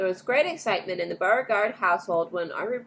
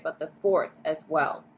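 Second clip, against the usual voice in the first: plain reading voice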